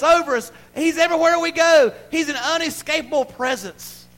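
high, loud, driving sermon shout; full-force preaching. Real, not acted. Preacher telling the church God has their back, full-force preaching.

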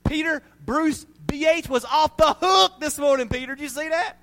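loud, high, thrilled shout to a friend; excited boasting. Acted. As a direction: holler it like a proud, amazed onlooker. God excitedly boasting to Peter.

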